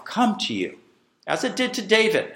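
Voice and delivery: ordinary delivery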